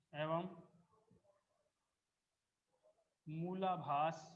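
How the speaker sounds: one word at a time with long pauses; spaced-out classroom dictation; slow dictation. Acted. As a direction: dictate slowly so students can write. Teacher dictating notes.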